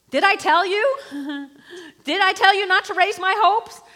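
loud, high, broken accusation; anguished screaming. Acted. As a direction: scream it through tears at the prophet. Screaming at the prophet.

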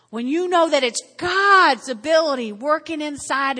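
loud preaching shout on God's. Acted. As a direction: shout it to the back row.

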